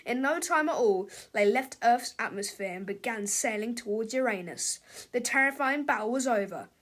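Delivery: ordinary delivery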